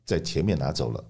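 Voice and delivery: ordinary talk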